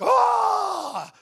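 long, high, loud "ah"; mimed victory roar; triumphant yell. Retold or imitated. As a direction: let out a drawn-out battle cry. Triumphant yell as dragon dies.

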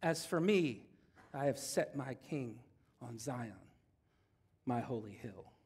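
stern parental face. Shown as a demonstration. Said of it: "thinking of that parental face".

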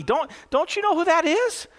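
can't believe mom doesn't know; incredulous little girl. Retold or imitated. Girl quoted in joke story.

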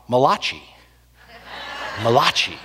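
"Malachi" said as muh-LAH-chee; Italian-style Malachi. Retold or imitated. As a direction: stress the middle of "Malachi", ending in chee. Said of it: "there finally was an Italian prophet".

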